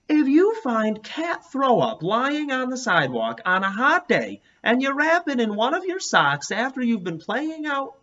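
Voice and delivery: put-on high, earnest rambling kid; Morris Boris's voice. A narrator's reading. Morris Boris explaining the smell to the class. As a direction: spin the gross story with total earnest.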